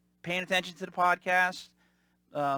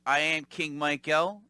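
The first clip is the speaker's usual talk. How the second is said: ordinary delivery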